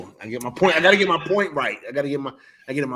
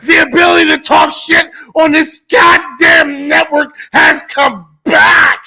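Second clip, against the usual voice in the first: excited shouting; 11 semitones higher, 10 dB louder than usual. Real, not acted. Hyped rant about show return.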